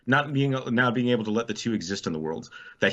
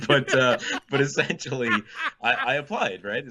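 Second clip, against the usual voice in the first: giggling through the words; laughing; 6 semitones higher than usual. Real, not acted. Laughing over the relationship.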